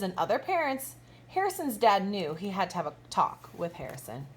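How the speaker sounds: lively, bright storybook read-aloud with big swoops; reading to children. A narrator's reading. Teacher reading a picture book to her kindergartners, animated storybook voice. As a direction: read it like story time for little kids.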